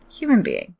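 ordinary delivery

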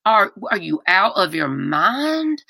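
imitated North Carolina drawl, very slow; slow Southern mock reproach. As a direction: quote him in a drawn-out Southern accent. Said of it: "He talked like this".

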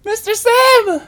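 put-on very high-pitched, loud kid squeal; excited yelling. Imagined students calling to their beloved teacher, yelling excitedly. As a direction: yell it like an adoring pupil calling out. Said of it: "yelling at you".

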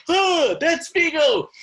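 slow, high-pitched delighted recognition; excited. Retold or imitated. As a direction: draw it out in happy surprise. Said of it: "and I was like".

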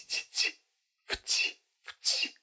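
short soft t, t and f, t sounds; isolated sounds. Shown as a demonstration. Teacher isolates f and t sounds. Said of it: "danach ein T".

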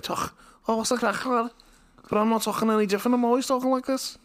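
very high, hammed-up Scouse; Scouse accent. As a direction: put on a squeaky Scouse lad. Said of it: "if I had a Scouse accent".

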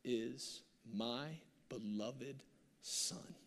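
a lightly voiced, slow, hushed voice from heaven; slowly, softly, hush it down to a whisper; a hint of God speaking from heaven; hushed divine voice. A narrator's reading. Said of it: "a voice cried out".

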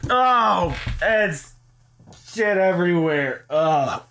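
high grossed-out groan; disgusted, high. Real, not acted. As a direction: squeal in disgust. After rinse, disgust.

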